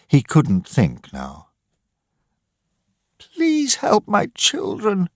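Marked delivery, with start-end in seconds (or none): 3.2-5.1 slow, high, pleading cry; a mother crying out for help. Acted. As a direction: beg for her children in tears. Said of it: "cried Missus Dawson".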